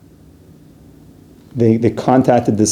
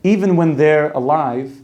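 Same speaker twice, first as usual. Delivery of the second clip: normal talking voice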